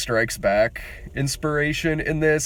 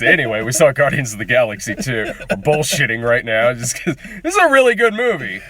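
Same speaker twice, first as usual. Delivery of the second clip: usual speaking voice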